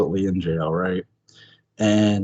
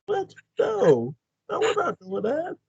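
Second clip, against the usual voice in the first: high, hushed mock disbelief; mortified witch; slower than usual. Co-host as the witch refusing Ben's suggestion, mock mortified. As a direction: refuse in a squeaky, scandalized little voice. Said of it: "Angelique was sort of mortified by his suggestion".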